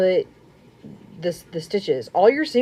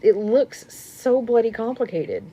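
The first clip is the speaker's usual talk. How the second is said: normal talking voice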